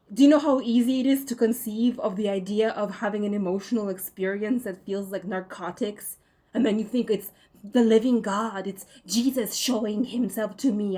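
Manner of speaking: loud, indignant challenge to viewers; loud angry rant. Real, not acted. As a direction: hammer the point home, loud.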